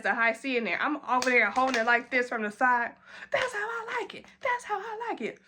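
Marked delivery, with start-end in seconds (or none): 3.3-5.4 much higher, softer tipsy coo; happy drunk squeal. Retold or imitated. Finally getting ketchup, high tipsy delighted coo. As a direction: coo it like a pleased tipsy child. Acting out drinking from side.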